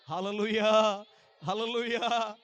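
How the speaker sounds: loud hallelujah. Real, not acted. Calls congregation to praise.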